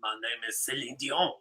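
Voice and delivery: mock French accent, low and quiet. Friend asks for her French accent, a low mock French voice. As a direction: put on a bad French accent. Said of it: "Your French accent".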